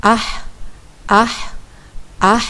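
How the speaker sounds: ah repeated from the middle of the throat; three short ah sounds from the mid throat; middle throat demo. Shown as a demonstration. Teacher drilling the second throat letter, repeated ah. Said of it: "kita coba latih dengan membaca".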